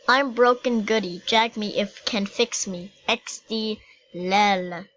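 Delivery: ordinary talk, nothing marked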